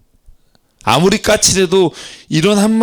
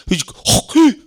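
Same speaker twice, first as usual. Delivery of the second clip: sudden high-pitched gasp; mock startled gasp; 9 semitones higher, much slower than usual. Preacher acting out Judah's narrow escape from Assyria, startled gasp. Acts out a near-death scare.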